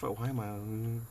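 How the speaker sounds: ordinary talk, nothing marked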